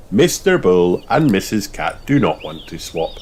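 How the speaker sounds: plain reading voice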